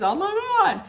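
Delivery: high-pitched mock-tearful gasp; crying oh my God. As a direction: imitate an overwhelmed, tearful birthday girl. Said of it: "yeah, she's crying".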